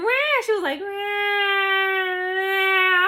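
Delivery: high, long imitated baby wail; stretched-out mock crying; slow-motion baby cry. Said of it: "she was crying in slow motion".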